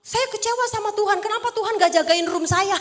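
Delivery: high, loud, aggrieved imitation; hammed-up indignant whine; mock angry complaint. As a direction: imitate a pastor ranting at God. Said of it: "Dia marah-marah, kesal sama Tuhan".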